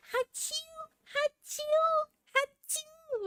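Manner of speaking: three quick high little sneezes; imitated sneezes. Shown as a demonstration. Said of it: "that's exactly how I sound like".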